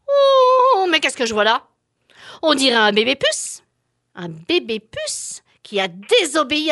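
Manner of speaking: loud stern bell tower; a grumpy steeple threatening to ring. Acted. Bell tower scolds and rings.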